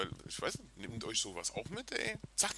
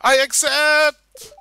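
high, slow, loud joyful cry; hammed-up overjoyed yes; excited acceptance; 20 dB louder, much slower than usual. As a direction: belt it out like a thrilled bride. Voicing NPC accepting proposal.

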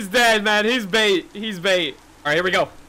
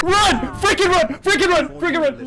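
high, urgent repeated yells; panicked shouting; 6 semitones higher than usual. Real, not acted. Player urging friends to run, panicked shouting. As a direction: yell at them to flee.

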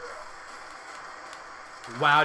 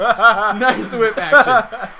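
laughing; 5 dB louder, much slower than usual. Real, not acted.

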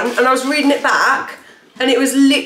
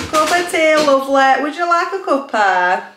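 put-on friendly Yorkshire lilt, higher; Yorkshire accent; 4 semitones higher than usual. Friend offering a cuppa, put-on Yorkshire accent. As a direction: play a friendly Yorkshire tea host.

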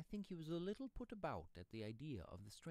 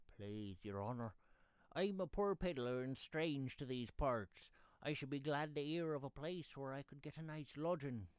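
higher, louder pleading of a poor pedlar; submissive pedlar; 4 semitones higher, 7 dB louder than usual. Acted. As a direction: appeal humbly to his honour, pitched up. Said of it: "he answered submissively".

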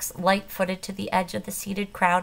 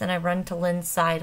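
usual speaking voice

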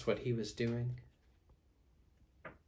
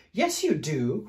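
mildly raised storybook cry; cried insistence; 6 semitones higher, 8 dB louder, slower than usual. A narrator's reading. Fluffy bear arguing about a bath, mild insistent cry. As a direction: insist a little louder. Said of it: "cried Big Fluffy Bear".

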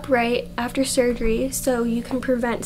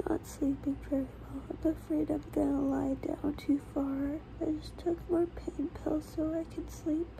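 late night whisper; 11 dB quieter than usual. Real, not acted. 2:30 AM clip, can't sleep.

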